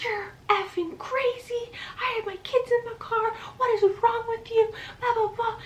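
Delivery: high-pitched strained imitation of a screaming neighbor; mock screaming. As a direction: screech it in falsetto without full volume. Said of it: "Screaming at me. She's like".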